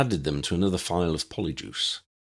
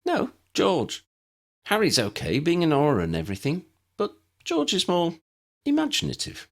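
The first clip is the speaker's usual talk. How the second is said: put-on higher young woman, brisk and teasing; young woman's voice; 10 semitones higher than usual. Ginny correcting Dennis about her teacher, higher teasing voice. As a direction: put the girl's voice up and keep it light.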